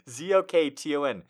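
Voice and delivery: ordinary talk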